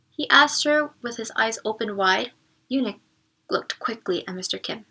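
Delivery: ordinary delivery, nothing marked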